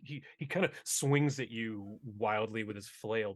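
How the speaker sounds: ordinary delivery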